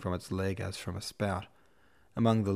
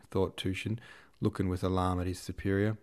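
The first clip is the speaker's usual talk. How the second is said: plain reading voice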